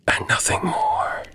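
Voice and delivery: breathy hushed whisper. A narrator's reading. Man alone in the dark, hushed whisper. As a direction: drop to a whisper, nervous.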